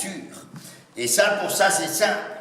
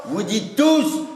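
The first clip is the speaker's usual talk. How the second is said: ordinary delivery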